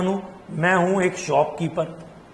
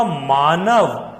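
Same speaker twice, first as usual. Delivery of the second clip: slow dictation; much slower than usual. Shown as a demonstration. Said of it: "तो अब मैं क्या बोलूंगा? मैं कहूंगा".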